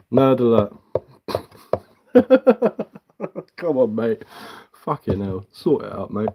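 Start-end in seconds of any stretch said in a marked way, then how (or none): none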